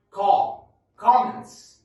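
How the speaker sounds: Comments said loud and high with an open o; loud model word. Shown as a demonstration. Teacher correcting how students say comments. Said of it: "اسمها إيه".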